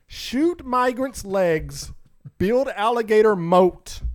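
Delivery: slow, punchy headline read. Shown as a demonstration. Reading the headline aloud to his friends, slow and emphatic. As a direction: spell out each outrageous word slowly. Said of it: "this is the headline".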